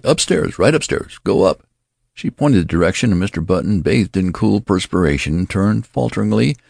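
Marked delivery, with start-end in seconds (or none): 0.0-1.7 high, flustered directions; hysterical. A narrator's reading. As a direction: send him off in a hysterical, raised pitch. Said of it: "she cried hysterically".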